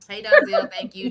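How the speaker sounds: high, loud greeting; excited shout-out. Real, not acted. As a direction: call the name out brightly. Calling out chat shout-out.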